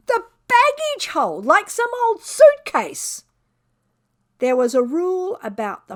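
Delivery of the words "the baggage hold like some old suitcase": scandalised at being luggage; outraged disbelief. A narrator's reading. Cat's outrage at baggage hold.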